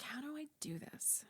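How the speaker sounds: hushed, whispered self-question; baffled whisper. Retold or imitated. Clown actor puzzling over a challenge, whispering.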